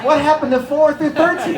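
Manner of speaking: high, laughing incredulous question; mock bafflement. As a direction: ask it like you cannot believe it. Mock outrage at skipped numbers.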